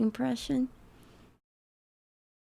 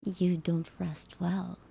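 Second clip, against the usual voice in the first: soft breathy whisper; 3 semitones lower, 5 dB quieter than usual. Pretend. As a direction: whisper it close and low. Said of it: "I wonder if I could sound like them".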